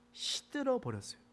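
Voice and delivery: drooping wilt. Shown as a demonstration. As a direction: sound the plant wilting on 시들어.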